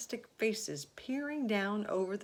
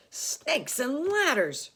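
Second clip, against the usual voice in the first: high, slow yelled cry; the fire chief yelling in alarm; 10 semitones higher, 11 dB louder, slower than usual. A narrator's reading. Fire chief yells at the giant peach, high and slow. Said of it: "yelled the head of the fire department".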